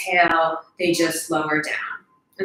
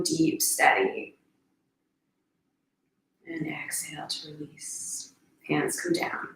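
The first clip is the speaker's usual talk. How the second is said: guided meditation; 6 dB quieter than usual. Real, not acted. Guide counting down the last breaths, soft meditation voice. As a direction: let each instruction settle, quiet and unhurried.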